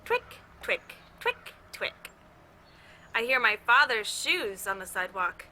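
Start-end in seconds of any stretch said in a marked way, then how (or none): 0.1-1.0 high whispered twick, twick. Shown as a demonstration. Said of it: "Can you do it with me?". 1.2-2.1 high, breathy whispered toenail clicks; whispered twick sounds. Shown as a demonstration. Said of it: "Can you do it with me?".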